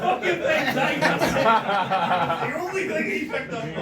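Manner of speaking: high, loud and slow through laughter; words squeezed out between laughs; laughing. Real, not acted. Laughing about what his son posted online.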